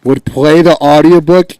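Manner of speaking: high, booming outburst into the mic; loud, high-pitched incredulous questioning; too loud. Real, not acted. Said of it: "gotta get away from the microphone".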